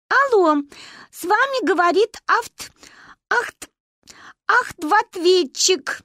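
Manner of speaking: put-on high, stiff robotic girl's voice, stumbling; mock answering machine. A narrator's reading. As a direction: play a child faking a machine voice. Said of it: "отрапортовала в трубку Маня".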